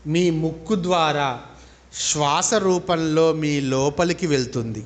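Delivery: slow, drawn-out meditation guidance; measured phrases with long settling pauses; guided meditation. Real, not acted. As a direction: lead them into breath awareness, unhurried.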